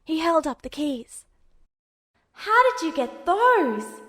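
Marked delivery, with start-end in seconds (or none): 2.3-4.0 higher, louder, slow amazed question; wide-eyed astonished boy. A narrator's reading. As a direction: marvel at the trick. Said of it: "Alphonse's voice was astonished".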